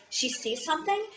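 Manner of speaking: ordinary talk, nothing marked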